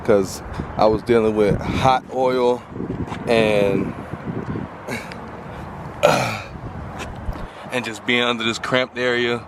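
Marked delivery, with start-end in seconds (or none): 4.0-7.7 long tired unvoiced sigh; weary sigh. Real, not acted. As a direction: let out a long weary breath. Cramped under car, dealing with oil.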